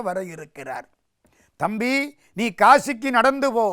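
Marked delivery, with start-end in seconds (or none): 1.6-3.7 raised, high-pitched order to a younger brother; stern command. Acted. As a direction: pass sentence firmly and loudly. Elder brother orders penance.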